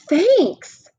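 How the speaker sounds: high, gasping thanks; surprised gasp. Acted. Brian is offered a cookie and gasps his thanks, high and surprised. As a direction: catch your breath in delight.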